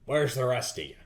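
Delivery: a hint of a proud old lizard official; dragonborn lord voice.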